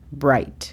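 "Bright" said slowly and clearly, English r; careful English "Bright"; bright model. Shown as a demonstration. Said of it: "La pronunciación fonética es".

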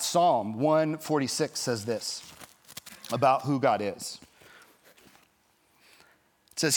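usual speaking voice

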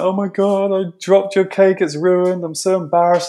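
high, wailing mock panic at mom; imitating a hysterical wail; retold screaming. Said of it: "screaming at my mom".

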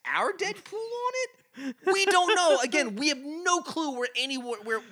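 high, squeaky confusion; exasperated bafflement. Real, not acted. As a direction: throw up a high-pitched, amused protest of cluelessness.

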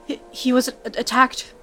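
spluttering. Acted. Brianna bursting in with her wounded friend, spluttering. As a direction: stumble over the words in a rush. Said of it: "Brianna spluttered".

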